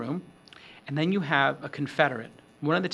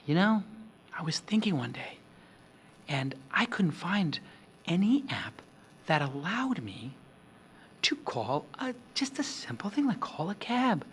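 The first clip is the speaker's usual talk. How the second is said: low voice; 4 dB quieter than usual. Shown as a demonstration. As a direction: draw them in with a near-whisper. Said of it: "maybe you could use a low voice".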